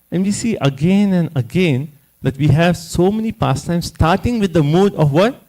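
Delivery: ordinary delivery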